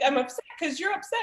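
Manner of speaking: put-on high, mildly whiny kid retort; upset daughter. Daughter answering her frustrated mother, upset. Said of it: "She's in tears".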